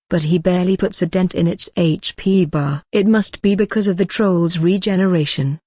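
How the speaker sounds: usual speaking voice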